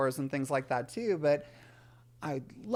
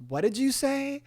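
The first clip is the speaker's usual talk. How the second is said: stern mom; 10 semitones higher, 4 dB louder than usual. Retold or imitated. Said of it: "And my mom goes".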